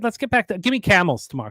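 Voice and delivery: ordinary talk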